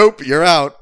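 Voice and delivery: loud, high-pitched dismissal; imitated loud, curt rejection; mock firm refusal. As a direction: belt it out like kicking someone out. Imitating hotel staff kicking them out.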